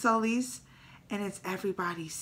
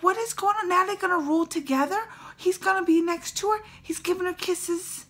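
shocked disbelief; 9 semitones higher, 5 dB louder than usual. Retold or imitated. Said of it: "you're like".

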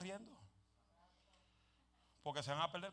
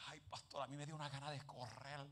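quiet, lowered confession; soft confiding; 4 semitones lower, 9 dB quieter than usual. Retold or imitated. The sister quietly confides to her pastor. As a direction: hush it like a shy confession.

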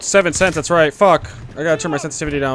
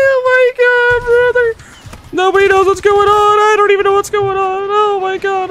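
much higher, louder panicked yelling; panicked shriek; 6 dB louder than usual. Real, not acted. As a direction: shriek it like the game is overwhelming you. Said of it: "I'm so scared!".

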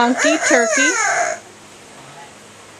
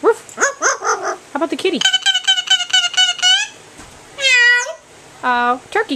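put-on high sing-song baby talk; reading to a toddler; 7 semitones higher than usual. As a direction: coo at a toddler. Adult talks to toddler with toy animals.

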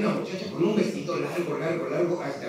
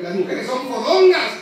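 much higher and louder; emcee shout; 9 semitones higher, 8 dB louder, much slower than usual. Real, not acted. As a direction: belt it out to provoke the crowd.

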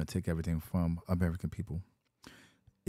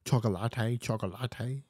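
higher "Chocolate, chocolate" in a put-on Spanish accent, choco-LA-te; mock Spanish vendor; 4 semitones higher, slower than usual. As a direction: hawk "chocolate" like a Spanish street seller. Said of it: "in your language in Spanish".

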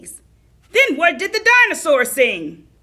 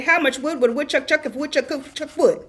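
rattled-off tongue twister; 3 dB quieter, faster than usual. Pretend. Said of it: "I bet he said".

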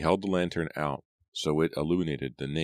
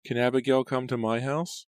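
put-on high girlish singsong taunt; very high, teasing child's question; 10 semitones higher than usual. A narrator's reading. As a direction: taunt like a ghostly little girl. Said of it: "and the taunting request".

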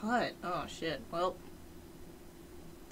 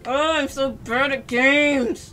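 self-mocking wail; 7 semitones higher, 12 dB louder, slower than usual. Real, not acted. Self-mocking after failure.

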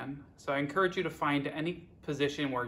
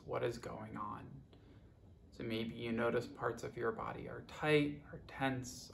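guided meditation; 7 dB quieter than usual. Acted. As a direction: guide them unhurriedly, letting each phrase settle. Leads a guided body scan.

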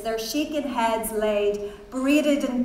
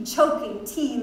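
ordinary delivery, nothing marked

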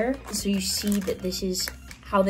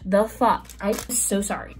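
normal talking voice

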